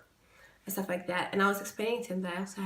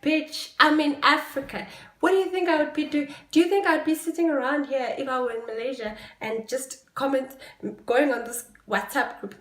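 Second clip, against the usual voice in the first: loud scornful comeback; sassy retort; 5 semitones higher, 4 dB louder than usual. Retold or imitated. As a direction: shut them down with attitude. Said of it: "And I'm like".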